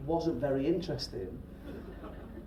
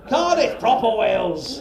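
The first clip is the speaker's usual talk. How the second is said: put-on loud, slow, higher Welsh accent; 6 semitones higher, 9 dB louder, slower than usual. Echoing a Welsh audience member, broad Welsh accent. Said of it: "Welsh accent".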